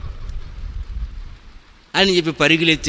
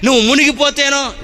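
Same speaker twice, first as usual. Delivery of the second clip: fiery preaching; 8 semitones higher than usual. Acted. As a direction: preach it with full force to the congregation. Preaching at full force.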